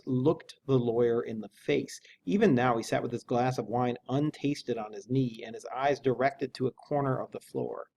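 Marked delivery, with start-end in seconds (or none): none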